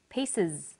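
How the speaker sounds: "Pieces" on its own, ending in a clear IZ; final IZ demonstrated. Shown as a demonstration. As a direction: model "Pieces" with a falling tune and voiced IZ ending. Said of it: "S on the end is pronounced z".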